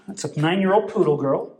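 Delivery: normal talking voice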